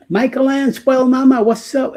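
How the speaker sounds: high, bright-hearted shout-out to a viewer; excited greeting. Real, not acted. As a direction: call out her name with a big lift.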